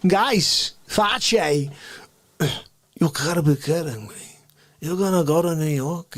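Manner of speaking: an indignant Bronx café regular demanding respect; New York accent, outraged, pitched high; Face character. Acted. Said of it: "Face appears".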